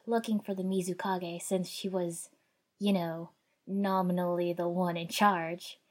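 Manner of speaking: plain reading voice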